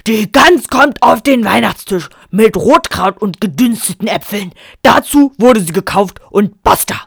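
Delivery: loud, booming final decree; thundering. A narrator's reading. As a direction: thunder it and slam the door. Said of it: "donnerte er jetzt los".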